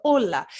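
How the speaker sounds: "Hola" with the H dropped, starting on O; silent H. Shown as a demonstration. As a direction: say "Hola" as "ola", firmly. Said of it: "No, that doesn't work".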